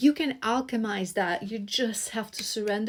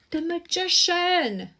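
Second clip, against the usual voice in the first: higher, slow, drawn-out announcement; slow high exclaimed card name; excited reveal; 9 semitones higher, 3 dB louder, slower than usual. Real, not acted. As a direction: proclaim the card with wonder.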